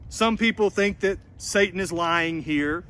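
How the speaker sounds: ordinary talk, nothing marked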